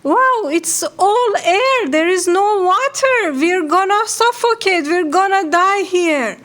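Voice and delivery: high, frantic little fish voice; panicked scream. Acted. Little fish panics falling through the air, high frantic cry. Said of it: "yellowfish was screaming".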